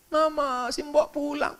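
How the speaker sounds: put-on high, soft child's wail for mum; crying child. Child telling mum the maid is going home, mock crying. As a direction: imitate a little kid whining tearfully. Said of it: "anaknya nangis".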